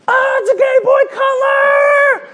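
very high, drawn-out delighted shout; excited squeal. Pretend. Little sister shrieking over her Game Boy, high and thrilled. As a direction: squeal like a little girl with her new toy.

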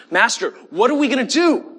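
high-pitched, urgent panic; panicking. Retold or imitated. Servant sees armies surrounding the city and asks his master, panicking. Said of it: "And he's panicking. He says".

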